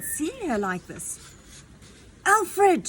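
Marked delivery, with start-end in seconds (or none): 2.2-2.9 high, loud shout over the shoulder. Acted. Queen shouting back to her son while dragged, loud shout. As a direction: yell back to him in alarm. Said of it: "she shouted over her shoulder".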